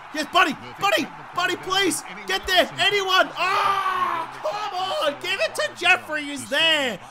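high, urgent calls to teammates; frantic pleading. Real, not acted. Urgent pleading to teammates during play.

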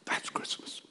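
hushed, very soft whisper. Real, not acted. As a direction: drop to a whisper after the climax. Hushed repeat after shouted climax.

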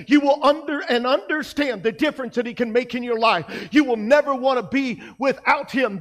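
ordinary delivery

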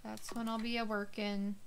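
normal talking voice

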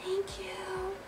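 tiny, high, very soft voice; whispered. A narrator's reading. Peter says it so nobody hears, hushed whisper. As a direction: barely let it out, high and hushed. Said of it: "whispered so quietly".

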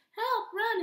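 very high, loud alarm shout; panicked cry. Acted. Frog stung by a bee warns everyone, panicked shout. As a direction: frog yelling in fright. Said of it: "he cried".

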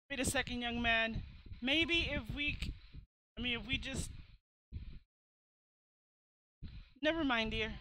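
lightly voiced old lady, faltering; hesitant old lady. Old lady stopping the young man as he leaves, hesitant. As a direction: start a plea, then give it up.

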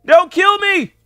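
high, loud screamed plea; panicked plea. Real, not acted. Player begging a game enemy, panicked screamed plea. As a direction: beg at the top of your voice.

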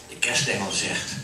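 usual speaking voice

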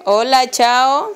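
slow, high drawn-out coo. Real, not acted. Parent waving bye to the baby, slow high coo.

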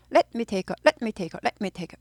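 "let me take" in clipped, separate beats; staccato let me take. Shown as a demonstration. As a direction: chop "let", "me", "take" apart crisply. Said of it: "이렇게 딱딱 끊어야 됩니다".

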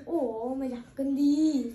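mum's loud nagging. Retold or imitated. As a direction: bellow the order like a nagging mother. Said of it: "mẹ bảo".

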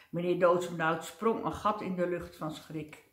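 usual speaking voice

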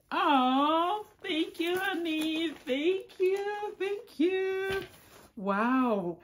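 high, loud gasping delight; thrilled exclamations. Real, not acted. Wife thanking husband for a surprise gift, loudly thrilled. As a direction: let the joy burst out.

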